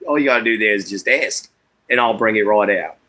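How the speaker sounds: relaxed put-on Australian accent; chatty Australian accent for the fans. Shown as a demonstration. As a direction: keep up the Aussie accent, obligingly. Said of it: "Can we hear the Australian accent?".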